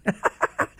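short chuckle after an impression; brief breathy chuckle; quick amused laugh. Real, not acted. Laughs after his imitation.

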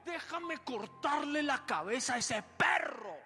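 high, loud, slow threat; angry warrior shout. Acted. Abisai shouting to David, eager to strike. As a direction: demand permission to kill. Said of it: "le dijo".

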